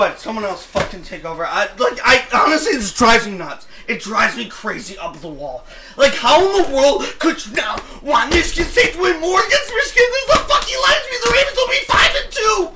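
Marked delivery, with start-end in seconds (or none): 6.0-12.7 high, exasperated yell; incredulous shout. Real, not acted. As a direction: shout your disbelief at him. Ranting at co-host in disbelief.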